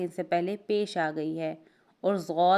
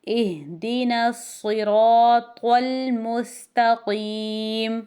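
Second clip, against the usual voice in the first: slow, drawn-out melodic recitation with a full stop; Quran recitation; 3 semitones higher, 5 dB louder, much slower than usual. Shown as a demonstration. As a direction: recite it like tilawat, stretching each vowel. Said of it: "تو اس کو ہم پڑھیں گے". (تلاوت کے انداز میں آہستہ)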